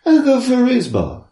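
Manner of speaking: high crying mock shout. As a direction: wail it out high. Sung/shouted joke line.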